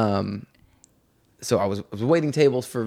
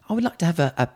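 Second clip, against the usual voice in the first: put-on higher-pitched, polite British accent; British girl; 5 semitones higher than usual. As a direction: imitate a little British girl ordering. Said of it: "they just happened to be British".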